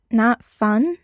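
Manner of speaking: mildly incredulous question, rising at the end. A narrator's reading. Hardison questioning Parker's boredom with magic money, mildly incredulous. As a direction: echo it back in disbelief.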